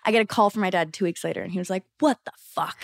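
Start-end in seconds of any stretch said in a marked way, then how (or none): 2.0-2.8 gruff mock yell from dad; dad's shocked outburst. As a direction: play the stunned father on the phone.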